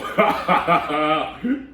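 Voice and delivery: rising giggle fit; elephant giggling. Acted. Elephant can't stop giggling at the mouse's joke, rising giggles. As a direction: burst into helpless giggles. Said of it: "He began to giggle".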